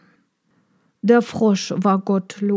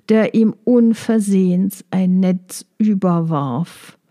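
ordinary delivery

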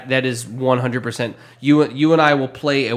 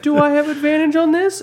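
much higher-pitched questioning voice; high, imploring mock question; mock pleading player; 14 semitones higher than usual. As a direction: put on a high voice for a player's request. Player's question to the game master.

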